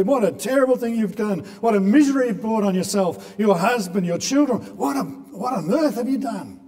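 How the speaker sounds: high, loud ranting rebuke; hammed-up outraged scolding, pitched high; mock ranting. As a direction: rant and rave at the sinners. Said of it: "rant and rave at them and say".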